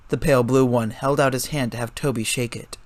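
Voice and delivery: ordinary delivery, nothing marked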